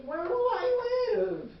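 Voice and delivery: put-on quavering elderly woman, pleading; high, slow old lady voice. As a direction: lift it high and slow like a frail grandma.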